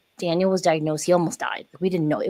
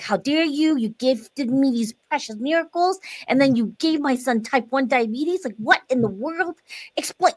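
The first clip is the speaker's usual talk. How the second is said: higher pitched, indignant retold outburst; replaying her fury at God; retold anger at God; 6 semitones higher than usual. Mother retelling her outrage at God, indignant. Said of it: "I was so mad".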